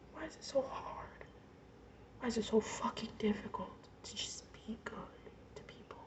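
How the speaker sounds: a real, tearful whisper; words barely breathed out through tears; broken whisper. Real, not acted. Telling her mother she's imaginary, in a crying whisper. Breaking down, asking why.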